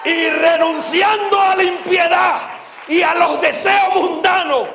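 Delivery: slow, high-pitched pulpit shout; prédica a gritos. Real, not acted. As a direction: thunder the verse slowly at full voice. Cita la Biblia predicando a gritos. (versículo gritado desde el púlpito)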